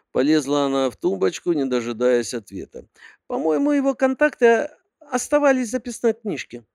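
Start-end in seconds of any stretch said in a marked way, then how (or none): 3.3-6.7 put-on high girly secretary voice; sweet, high-pitched young secretary; cartoon princess. A narrator's reading.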